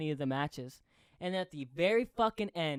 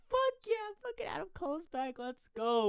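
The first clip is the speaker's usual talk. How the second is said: much higher, thrilled cheer; excited cheer; 13 semitones higher than usual. Retold or imitated. Fan reliving the moment the star returned, cheering. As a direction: cheer like the fan you were. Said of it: "I was like".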